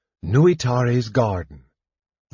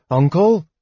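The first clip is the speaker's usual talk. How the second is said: higher, louder hopeful call; crying out; 7 semitones higher, 6 dB louder than usual. A narrator's reading. Palin leans toward the darkness, crying out to uncle. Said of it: "he cried".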